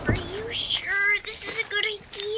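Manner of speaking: nervous whisper. Acted. Becca hiding, whispering her doubts to Ash. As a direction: doubt it under your breath.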